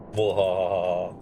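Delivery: slow hammed-up evil laugh; a pantomime villain's mwahaha. Welcoming a new cult member.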